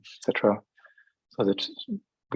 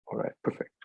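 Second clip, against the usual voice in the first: whispered aside while busy; barely voiced self-talk; muttered to self; 10 dB quieter, faster than usual. Real, not acted. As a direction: say it under your breath, very softly. Talking to self while finding slide.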